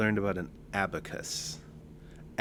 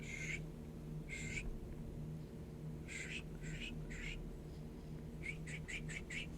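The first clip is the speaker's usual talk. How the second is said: soft shh-shh of sliding beads; hushed mimicry of someone working an abacus; imitated abacus noises; 16 dB quieter, much slower than usual. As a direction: make quiet, spaced-out hissing abacus sounds. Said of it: "and he's like".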